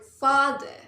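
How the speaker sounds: "father" with a loud, weighted first syllable; "father", first syllable stressed, second let go. Shown as a demonstration. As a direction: lean firmly on the "fa" in "father". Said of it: "find the stress mark in the syllable".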